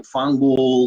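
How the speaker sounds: fangul, drawn out slowly in a put-on Jersey-Italian way; mock Italian curse. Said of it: "that's not even Italian".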